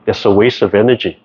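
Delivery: usual speaking voice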